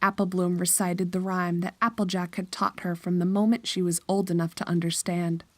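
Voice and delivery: ordinary talk, nothing marked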